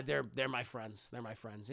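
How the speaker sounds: low, soft acted mumble; subdued, low imitation; quiet admission. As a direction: trail down as if admitting it to yourself. Character admits it to himself.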